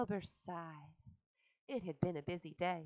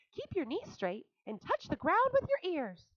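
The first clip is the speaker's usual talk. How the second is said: high, louder instruction; calling; 11 semitones higher, 5 dB louder than usual. A narrator's reading. Charlotte coaching Wilbur, high and loud. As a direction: call out the order. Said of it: "called Charlotte".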